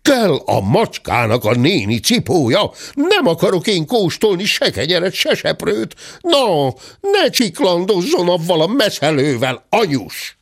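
high-pitched protesting cries; acted indignant yelling. A narrator's reading. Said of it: "Ez nagyon kiabált".

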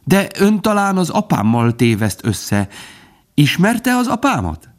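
high, excited squirrel voice; chatty squirrel. A narrator's reading. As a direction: prattle on eagerly with leaps up in pitch. (csacsogó, magas hangú mókus)

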